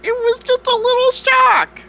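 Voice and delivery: very high, pleading little voice; squeaky plea. Acted. Adventurer excusing himself to the farmer, very high.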